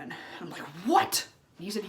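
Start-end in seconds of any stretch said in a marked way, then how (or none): 0.8-1.3 high, loud startled yelp; shocked what. Retold or imitated. Reacting to James saying the door was open, loud and shocked. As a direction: jolt up in disbelief. Told the front door's open.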